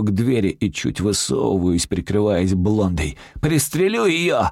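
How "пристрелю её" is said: high-pitched threatening yell; yelled threat. A narrator's reading. Robber holding a hostage yells a threat. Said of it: "ору".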